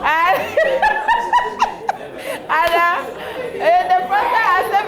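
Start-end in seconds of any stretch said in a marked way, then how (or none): 0.4-2.4 a shrill burst of laughter; high laugh. Real, not acted. Laughs at wedding memory.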